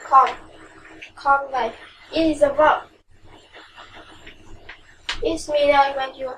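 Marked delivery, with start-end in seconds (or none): 0.1-1.7 teacher isolates "Con-" to show stress on the first syllable; split for stress. Shown as a demonstration. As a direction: break off the stressed "Con-" first and then give the full word. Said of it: "The stress is in the first syllable".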